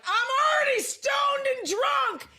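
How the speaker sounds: put-on slow, high, very loud drunken holler; drunk shouting. Imitating drunk people at a show, slow drunken shout. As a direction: bellow it like someone wasted, stretching the words. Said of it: "talks to me with a really drunk face".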